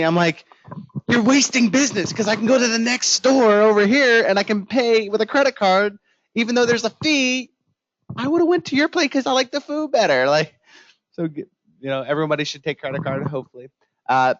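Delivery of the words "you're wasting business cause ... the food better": mock exasperated rant. Ranting at cash-only shop.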